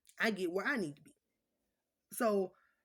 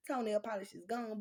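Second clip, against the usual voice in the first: ordinary talk, nothing marked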